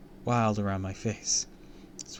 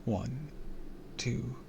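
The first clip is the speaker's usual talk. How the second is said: whispered counting; 3 semitones higher, 7 dB quieter, much slower than usual. A narrator's reading. Counting to himself.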